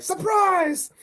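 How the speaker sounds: very high, drawn-out mock leprechaun shout; spring it on them in a squeaky put-on voice; gleeful high imitation; jumping-out surprise. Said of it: "He jumps out".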